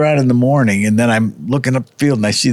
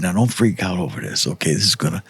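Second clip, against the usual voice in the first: hushed; 4 semitones lower, 6 dB quieter than usual. Retold or imitated. Whispering to partner in blind.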